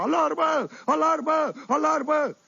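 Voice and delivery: high repeated yell of alarm; dazed yelling. Retold or imitated. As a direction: shout it over and over, still stunned. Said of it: "Fugea prin dormitor și urla".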